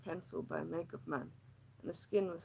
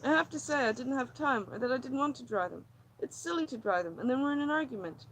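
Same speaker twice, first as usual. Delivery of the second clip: higher, tense, pleading woman; distressed woman; 5 semitones higher, 9 dB louder than usual. Acted. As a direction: pour out her misery, near breaking. Lily's agitated monologue.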